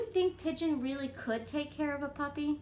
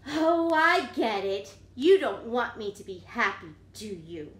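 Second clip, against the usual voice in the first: sulking pigeon; 2 semitones lower, 7 dB louder than usual. Acted. Pigeon accusing the reader of ruining his fun, sulky.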